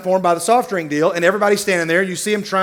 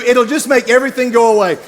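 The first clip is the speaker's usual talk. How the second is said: ordinary talk, nothing marked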